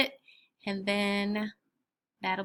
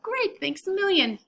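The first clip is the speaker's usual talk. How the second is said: happy; 10 semitones higher, 3 dB louder than usual. Acted. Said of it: "I did a little happy dance".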